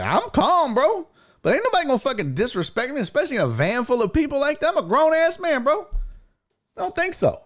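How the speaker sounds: high, fast, indignant retort; riled insistence. Retold or imitated. Passenger told to calm down, riled insistence. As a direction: claim calm while plainly worked up.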